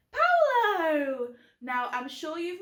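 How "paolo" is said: a squeaky little puppet voice, higher and louder; penguin puppet voice. Pretend. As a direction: pop the puppet's name out brightly.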